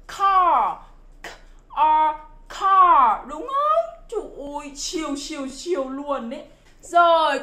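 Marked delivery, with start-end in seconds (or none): 0.1-3.3 loud "car" with puffed k and curled r; English car. Shown as a demonstration. Teacher shows how natives say it, loud aspirated "car". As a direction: sound out "k", "r", then a big drawn "car". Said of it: "thì bạn phải phát âm là".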